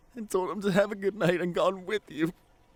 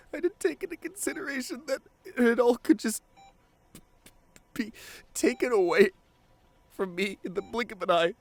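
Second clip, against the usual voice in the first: guilt breaking through; 7 semitones higher than usual. Acted. Partner confessing regret to an unconscious love, straining with guilt. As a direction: let the guilt crack the voice open. Guilt welling into tears at bedside.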